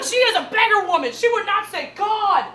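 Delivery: loud, high, hammed-up outrage; mock indignant protest. Actress defends her role to classmates, indignant. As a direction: protest at the top of your voice.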